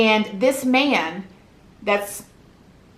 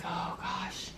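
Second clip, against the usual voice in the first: whispered dread under the breath; unvoiced whispered oh gosh; 4 semitones lower, 17 dB quieter than usual. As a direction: whisper it, dreading what's coming. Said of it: "And I was like".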